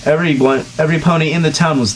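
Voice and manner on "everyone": normal talking voice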